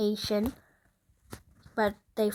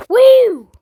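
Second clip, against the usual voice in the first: loud high whoop; loud excited cowboy whoop; 12 semitones higher, 16 dB louder than usual. Pretend. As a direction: whoop like a rodeo rider. Cowboy whoop in Wild West game.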